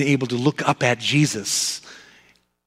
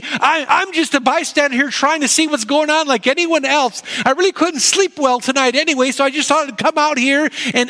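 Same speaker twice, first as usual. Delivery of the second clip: higher, quicker voice of a nervous Peter pleading innocence; Peter, anxious and defensive; 10 semitones higher than usual. Acted. Peter to the courtyard crowd, denying he knows Jesus, nervous and higher. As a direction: play Peter insisting he's just a bystander, pitched up.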